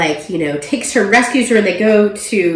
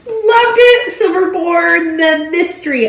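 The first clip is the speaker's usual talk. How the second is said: gushing; 11 semitones higher, 4 dB louder than usual. Real, not acted. Gushing about a favorite book.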